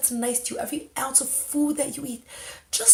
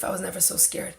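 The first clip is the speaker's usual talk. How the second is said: normal talking voice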